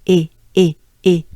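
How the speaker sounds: loud, short é for each E; punchy repeated E as in café; French é sound. Shown as a demonstration. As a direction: drill E as é, sent upward in the mouth. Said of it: "it goes up towards, uh, your nose".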